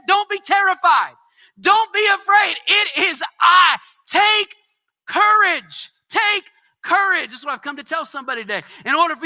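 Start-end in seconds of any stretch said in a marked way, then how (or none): 0.1-2.6 "Don't" shouted high and loud; shouted proclamation. Acted. Preacher repeating Jesus's words, now high and shouted. 2.7-7.3 high, loud proclaiming; full-force preaching. Acted. Preacher shouting Jesus's words of courage, high and loud.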